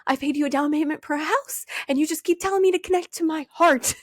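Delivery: hammed-up exasperated complaint; frustrated rant. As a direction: vent at the mentor, rising to a yelp. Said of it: "And I was like".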